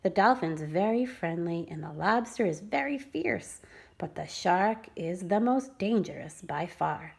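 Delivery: plain reading voice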